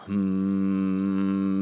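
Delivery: sustained low steady hum. Shown as a demonstration. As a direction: hum very low on one held note. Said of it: "hum very low in your spare time".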